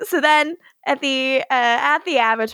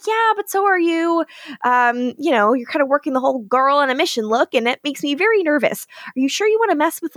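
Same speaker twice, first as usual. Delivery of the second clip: quick playful Josh; 4 semitones higher, faster than usual. Retold or imitated. Josh quoted in recap.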